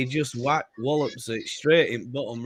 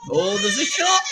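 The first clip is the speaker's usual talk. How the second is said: excited shout; 6 dB louder than usual. Real, not acted. Live match reaction, shot.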